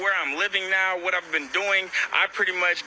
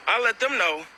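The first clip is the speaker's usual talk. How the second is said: usual speaking voice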